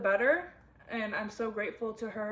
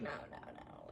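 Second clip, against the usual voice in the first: dismissive no; 8 semitones lower, 17 dB quieter than usual. Pretend. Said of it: "it's easy to be like".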